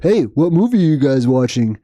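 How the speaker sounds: lightly mocking a clueless viewer; a touch of put-on obliviousness; clueless chat latecomer. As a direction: imitate someone butting into the chat. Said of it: "jump in the chat and be like".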